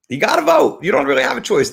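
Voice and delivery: high-pitched, emphatic plea; urgent exclamation. Real, not acted. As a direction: burst out high, urging everyone. Exclaimed plea to listeners to vote.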